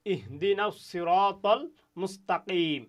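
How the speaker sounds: verse recital; Quranic recitation. Shown as a demonstration. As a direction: recite it slowly and clearly.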